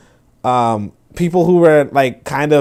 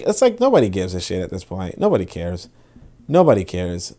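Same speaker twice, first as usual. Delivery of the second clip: ordinary talk, nothing marked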